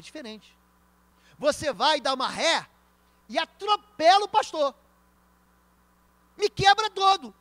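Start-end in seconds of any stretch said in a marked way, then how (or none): 3.3-7.3 high and loud, hammed-up outrage; loud, dramatic. Preacher acts out being run over, in a loud, high voice. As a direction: belt it out to the congregation.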